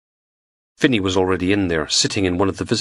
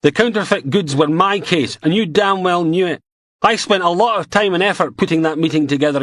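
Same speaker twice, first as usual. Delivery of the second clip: angry rant; 13 semitones higher, 3 dB louder than usual. Acted. Beattie ranting at Logan.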